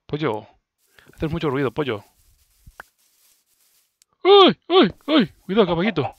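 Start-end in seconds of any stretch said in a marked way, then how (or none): 4.2-5.3 high, loud startled yelps. Real, not acted. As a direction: yelp in alarm. Startled cries.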